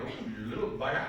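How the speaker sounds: chuckling through Oh, you little bugger; amused father laughing at his defiant boy; fond laughing exclamation. Retold or imitated. Son stands up to him.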